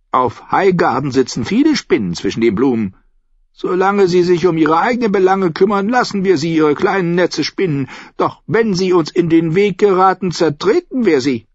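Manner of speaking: put-on high, loud old-woman voice; formidable old lady. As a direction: assert it like an imperious matriarch. Old lady reassures with menace.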